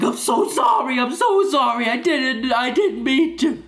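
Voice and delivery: bawling mom. Retold or imitated. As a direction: plead through mock sobs like a guilty mom. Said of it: "like, bawling".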